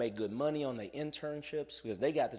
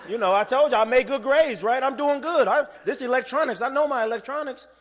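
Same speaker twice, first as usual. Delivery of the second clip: high, loud, bragging it up; proud student pumped up before the class; eager self-assured boast; 11 semitones higher, 8 dB louder than usual. Retold or imitated. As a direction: pitch it high and loud like you're sure you're the star. Confident student answering professor.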